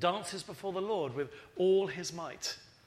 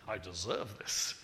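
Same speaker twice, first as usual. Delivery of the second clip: lower, slow smug mock voice; mock entitled; 9 semitones lower, slower than usual. Preacher mocking ungrateful people, low and slow. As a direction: play the spoiled ingrate, slow and low.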